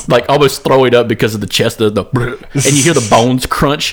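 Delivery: ordinary talk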